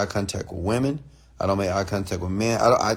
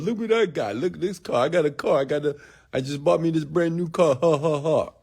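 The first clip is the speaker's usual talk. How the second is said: mock show-off; 5 semitones higher than usual. Mocking show-off at the club.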